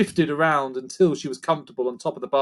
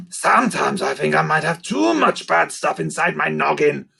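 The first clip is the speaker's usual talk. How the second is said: lightly voiced creature, a touch louder; creaker voice; 3 dB louder than usual. A narrator's reading. Narrator redoing Scratch's line, in a light creaker voice. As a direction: redo it as the creaker. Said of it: "that's one of the creakers".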